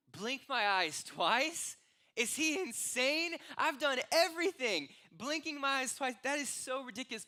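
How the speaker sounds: loud, high-pitched incredulous outburst; outraged disbelief. Acted. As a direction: protest it loudly, as if it's absurd. Acted outraged disbelief at absurd cure.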